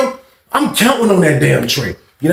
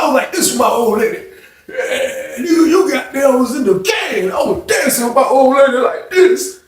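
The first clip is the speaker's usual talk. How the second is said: laughing brag; 10 semitones higher than usual. Retold or imitated. Fred laughing while bragging.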